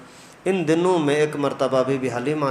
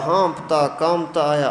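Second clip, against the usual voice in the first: normal talking voice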